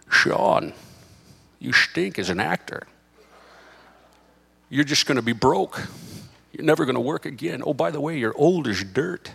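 lightly put-on, slurred actor impression; Sean Connery. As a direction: do a rough Connery with slushy s sounds. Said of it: "just like I did a terrible Sean Connery".

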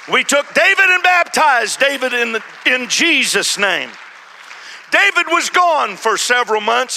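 loud, high preacher's cry; preaching at full force. Real, not acted. Preacher telling his church the story, at full force. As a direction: lift it like the Sunday sermon climax. Sermon climax, preaching hard.

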